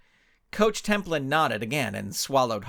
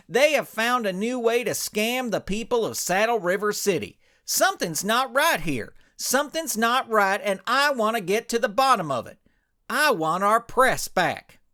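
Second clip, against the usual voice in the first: indignant cowboy hero; 8 semitones higher, 3 dB louder than usual. Acted. Ranch hand complains to his partners, indignant and loud. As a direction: puff up as a wounded hero cowboy.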